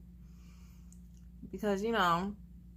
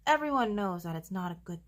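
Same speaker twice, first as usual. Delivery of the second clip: usual speaking voice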